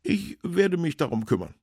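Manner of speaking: high, decided official voice; councillor character. A narrator's reading. Councillor promises to find work, high and decided.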